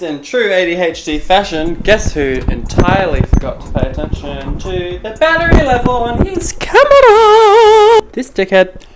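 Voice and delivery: loud, high exasperated rant. Real, not acted. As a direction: yell it out at yourself. Ranting about the dead camera battery.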